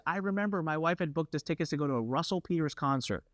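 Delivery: ordinary delivery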